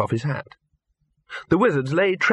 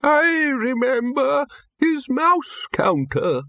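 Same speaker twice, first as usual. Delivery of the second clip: put-on old man voice; much slower than usual. A narrator's reading. Old wizard Windlepoons voiced.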